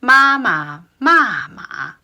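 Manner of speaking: slow MA MA MA MA, high and falling shapes; tone demonstration. Shown as a demonstration. Teacher finishing tongue twister, slow exaggerated tones. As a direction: draw out each "ma" with its own tone. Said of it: "four tones with a very famous".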